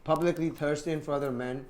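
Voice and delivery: ordinary delivery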